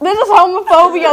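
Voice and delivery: loud, high laughing joke protest. Real, not acted. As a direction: cry out while laughing. Teased by friends.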